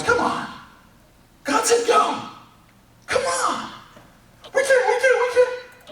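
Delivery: very high, squeaky, pleading comic voice. Retold or imitated. One guy begging the others into the sea, in a squeaky comic plea. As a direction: squeak it out like a panicking little guy.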